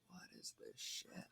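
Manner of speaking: whispered, disgusted aside; muttered disgust. Retold or imitated. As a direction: breathe it out like you can't believe the trash. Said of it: "I was like".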